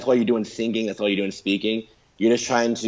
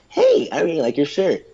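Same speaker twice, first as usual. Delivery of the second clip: high, exaggerated American lilt; swooping fake American intonation; fake American accent; 8 semitones higher, 3 dB louder than usual. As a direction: gush like a phony American. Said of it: "super fake American accent".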